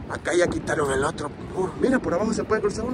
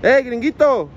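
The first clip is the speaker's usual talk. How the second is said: scolding the dog's owner from a distance; shouting; 6 semitones higher than usual. Real, not acted. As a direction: yell it over so he hears. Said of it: "Ahí le voy a gritar".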